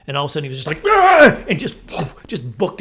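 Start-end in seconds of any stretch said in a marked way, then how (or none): 0.9-1.4 loud high yelp imitating a bear; startled bear cry. Bear suddenly notices the runner, loud startled cry. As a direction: blurt out a comic bear shriek.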